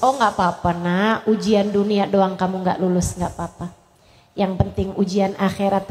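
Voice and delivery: put-on a mother calming her child after a failed exam; soothing mother. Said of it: "Adem nih punya emak kayak begini". (ibu menenangkan anak, lembut dan pelan)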